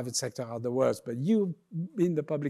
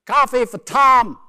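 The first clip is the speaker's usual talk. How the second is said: loud, high, drawn-out American barista; American accent; 8 semitones higher, 13 dB louder than usual. Acted. New York barista calling Tom's order, loud American accent. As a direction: holler the order slowly in hammed-up American. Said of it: "that's my American accent".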